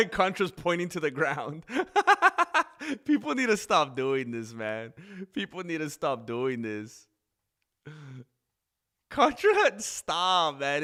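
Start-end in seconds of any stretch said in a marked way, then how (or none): 1.7-3.0 loud high laugh; sudden high-pitched laugh; burst of laughter. Real, not acted. Laughing at fighter's antics. 9.1-10.8 loud high shout of a name at the screen; exasperated shout at the TV; calling out. Real, not acted. Yelling at fighter on screen.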